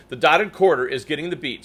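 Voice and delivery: ordinary talk, nothing marked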